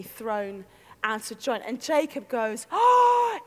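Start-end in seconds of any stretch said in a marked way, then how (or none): 2.7-3.4 high, loud gasp of shock; pained gasp. Acted. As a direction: gasp as if the hip gives way. Jacob gasps as hip dislocates.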